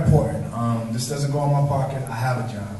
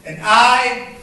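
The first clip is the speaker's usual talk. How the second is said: rhythmic spoken word performance, building and falling; spoken word poem; 5 semitones higher than usual. Acted. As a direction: perform it like a slam poem on an open mic stage.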